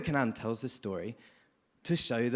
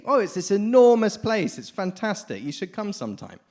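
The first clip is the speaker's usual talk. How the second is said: higher and louder, gushing, then easing off; enthusiastic sea frog; 5 semitones higher, 5 dB louder than usual. Acted. Sea frog inviting the well frog to the ocean, gushing and louder. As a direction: sell the wonders of the ocean. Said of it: "And he says".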